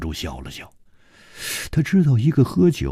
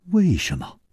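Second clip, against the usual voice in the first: higher-pitched, startled question; astonished; 6 semitones higher than usual. A narrator's reading. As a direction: ask in sudden bewilderment. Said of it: "楚留香更吃惊了". (吃惊地追问)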